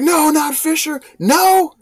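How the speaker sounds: high, loud anguished no; dismayed cry. Retold or imitated. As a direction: cry out at the news of the fire. Reacting to the Fisher fire.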